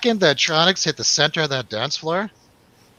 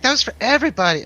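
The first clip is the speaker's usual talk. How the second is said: high, fast imitation of a friend's accent; [name]'s accent; 12 semitones higher, much faster than usual. Friend scolding over the shared acid, high imitated accent. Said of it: "I'll do the accent".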